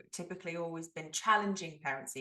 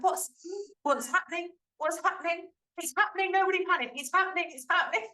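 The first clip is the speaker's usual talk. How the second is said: high-pitched, repeated panicky exclamations; mock panic; 9 semitones higher than usual. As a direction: play up a flustered parent's alarm. Acting out her own excited panic.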